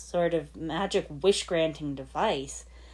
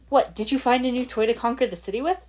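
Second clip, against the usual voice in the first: higher and louder, with a sharp edge; biting; 7 semitones higher, 6 dB louder, faster than usual. Acted. Lloyd confronting his father with a bite. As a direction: bite into the accusation. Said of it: "his voice taking on more of a bite".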